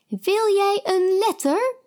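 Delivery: high odd voice offering een letter; odd lady. A narrator's reading.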